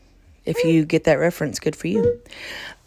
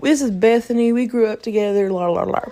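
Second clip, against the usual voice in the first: hammed-up pal brushing off a dare, trailing into la-la; mock protest, pitched up and easing down; Fish; 6 semitones higher than usual. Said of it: "And Fish was like".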